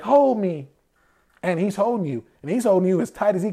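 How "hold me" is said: high, louder mock plea to be held; needy plea. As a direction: play a clingy partner begging. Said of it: "you can be like".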